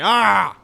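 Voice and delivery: sudden loud, high "Ah!" yell; aggressive yell. Shown as a demonstration. Showing friends what an aggressive person says, aggressive yell. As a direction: burst out with a wordless attacking shout. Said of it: "an aggressive person wouldn't say anything".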